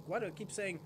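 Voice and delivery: normal talking voice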